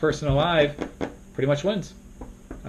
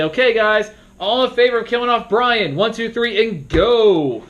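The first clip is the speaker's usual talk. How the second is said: upbeat host call; 8 semitones higher than usual. Shown as a demonstration. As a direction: call the vote to the whole table. Moderator calling the vote.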